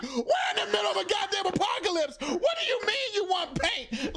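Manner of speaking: high, incredulous mock outburst; exasperated arguing. Arguing over paint in an apocalypse, exasperated high outburst. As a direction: protest in high-pitched disbelief, stretching the question. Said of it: "having a real live argument".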